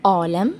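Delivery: عالم with no throat ayn, just a long aa; ayn as long alef. Shown as a demonstration. Teacher showing Persian ayn, عالم begun like alef madd. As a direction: open عالم on a plain long aa. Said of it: "العين كمان بتنطق الألف المد".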